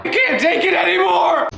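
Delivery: high-pitched comic shout; exasperated mock outburst. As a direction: cry out like you've hit your limit. Mock breakdown over flirting comments.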